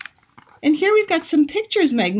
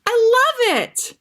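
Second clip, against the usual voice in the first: high, excited delight; thrilled by the plan; delighted exclamation; 12 semitones higher than usual. Acted. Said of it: "Miss Emily exclaimed".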